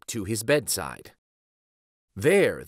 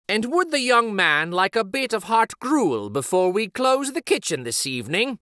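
innkeeper voice; 8 semitones higher than usual. Acted.

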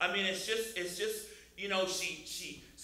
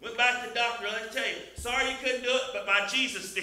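loud, high, impassioned testimony; ringing pulpit delivery, pitched up; full-force preaching; 7 semitones higher, 8 dB louder than usual. Acted. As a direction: proclaim it to the whole congregation.

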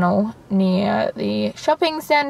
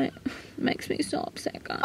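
whisper; 10 dB quieter than usual. Real, not acted. Sad about barking pound dogs.

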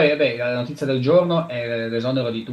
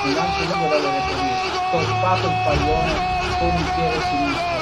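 shouting commentator. Acted. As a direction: keep yelling the replay. Goal replay call high.

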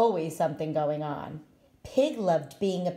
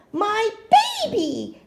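a high, loud, overjoyed cry; proud cry; 6 dB louder than usual. Acted. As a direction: burst out with pride at the hatchling. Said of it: "cried Pig with pride".

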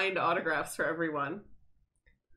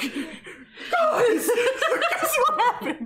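laughing, high and louder; playing the show-off hero, giggling; hammed-up bragging voice through laughter; high mock hero voice; 5 dB louder, much slower than usual.

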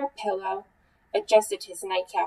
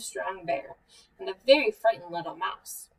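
usual speaking voice

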